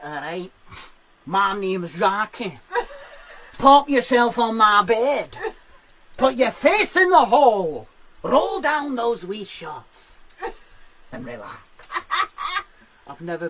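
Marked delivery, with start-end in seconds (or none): 0.0-11.9 slow, looming Scottish masseuse voice, imitating Jackie; slow and menacing in a Glaswegian accent; mock-soothing Glaswegian accent. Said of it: "that is not the accent for a massage".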